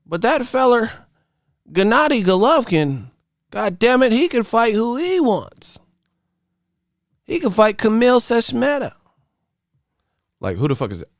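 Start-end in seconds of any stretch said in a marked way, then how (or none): 0.1-8.9 high-pitched caricature of a biased pundit; high, hammed-up parody; mock old-media fan. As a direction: champion the favorite in a silly high voice. Old media defending Golovkin, mocked.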